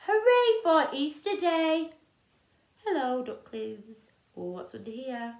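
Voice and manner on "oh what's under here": ordinary delivery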